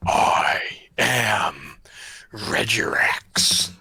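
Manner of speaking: loud, slow, low boom; booming monster voice. Acted. Dragon announces itself to intruders, loud and booming. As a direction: boom it out slowly, shaking the foundations. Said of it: "a loud, booming voice that shakes".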